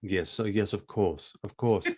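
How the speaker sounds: servile butler. Acted.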